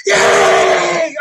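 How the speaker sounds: cheering shout. Retold or imitated. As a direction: yell like rival fans celebrating. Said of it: "you just hear people going".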